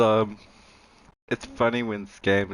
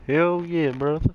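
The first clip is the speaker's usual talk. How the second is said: put-on American accent; 6 semitones higher, 3 dB louder than usual. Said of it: "bringing them out, the American accent now".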